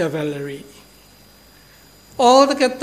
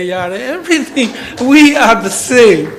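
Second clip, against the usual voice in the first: laughing; 4 semitones higher, 9 dB louder than usual. Real, not acted. Delighted to find shared words.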